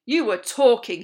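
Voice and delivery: loud, hard bark; barked accusation. A narrator's reading. Captain accusing a pupil with an angry bark. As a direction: bark it like a sergeant. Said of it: "he barked".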